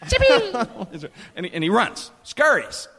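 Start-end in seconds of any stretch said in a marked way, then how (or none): 0.1-1.1 much higher, sharp comic call; high-pitched cry. Retold or imitated. Man hitting back at the dog, high-pitched cry. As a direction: yelp the dog's name up high.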